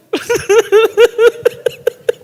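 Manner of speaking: high laugh. Real, not acted. Preacher laughs at own joke.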